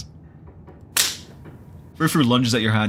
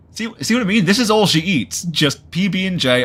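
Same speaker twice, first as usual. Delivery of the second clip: excited; 8 semitones higher, 5 dB louder than usual. Real, not acted. Excited rant about Tabitha.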